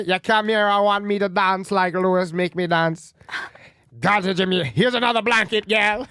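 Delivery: lightly put-on Jamaican accent, teasing; hint of a Jamaican girl, playful. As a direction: loose Jamaican patois lilt. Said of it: "You want me to do Jamaican girl?".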